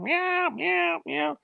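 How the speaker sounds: high, mewing cat sounds; imitated cat meow. Teller imitates the cat on the ghost recording, high meowing. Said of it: "the cat's going".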